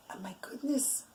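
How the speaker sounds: breathless whisper of wonder; whispered thought. Acted. Reb Zev marvels to himself in a whisper. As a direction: whisper it as a private amazed thought.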